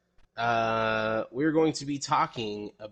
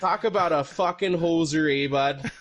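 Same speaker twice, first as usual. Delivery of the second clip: hammed-up Canadian, slightly higher and louder; mock Canadian hoser slang, a little higher; Canadian accent; 5 semitones higher, 4 dB louder than usual. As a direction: lean hard into the Canadian eh. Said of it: "do this in your Canadian accent".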